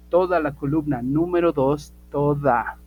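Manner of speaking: "toda" punched loud both times; stress on TODA. Shown as a demonstration. Teacher insisting the whole column counts, emphatic TODA. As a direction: hit "toda" hard and stretch it. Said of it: "y si digo toda, es toda".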